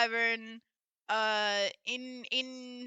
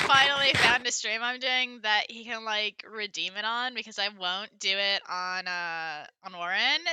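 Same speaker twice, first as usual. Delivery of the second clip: put-on valley girl accent; 4 dB louder than usual. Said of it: "be redeemed Valley Girl accent".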